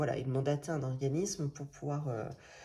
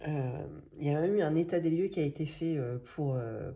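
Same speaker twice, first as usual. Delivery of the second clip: ordinary talk, nothing marked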